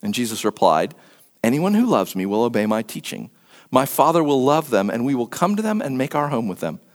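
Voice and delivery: low, measured Bible reading; scripture reading. A narrator's reading. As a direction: read the passage aloud, low and solemn.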